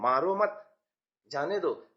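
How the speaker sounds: मारो मत joined and rising, then जाने दो; pause after मत. Shown as a demonstration. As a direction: break after मत, easing off on जाने दो. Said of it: "आप दया दिखा रहे हैं".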